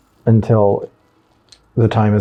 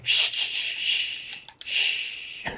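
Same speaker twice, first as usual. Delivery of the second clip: soft, repeated shushes; mock soothing shush; 14 dB quieter, much slower than usual. Player calming self after a slip, mock shushing. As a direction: hush gently, in little bursts.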